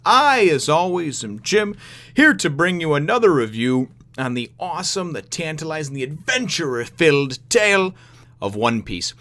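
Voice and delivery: high, stretched-out opening hello; hyped show host greeting. Pretend. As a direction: greet the fans like a big showman.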